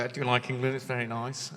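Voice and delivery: soft, low put-on English accent. Scottish comic imitating his London crowd, English accent. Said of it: "I'm doing your accent now".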